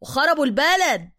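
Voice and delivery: loud, high lament; exclaiming Egyptian driver. Acted. Driver curses those who ruined homes and country, loud lament.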